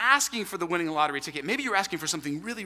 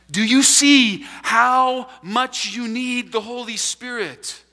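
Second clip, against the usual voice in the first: louder, slow, higher and weighty; slow loud plea; 5 semitones higher, 6 dB louder, slower than usual. Real, not acted. Pastor presses his congregation, slow and loud. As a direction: lean on every word, slow and loud.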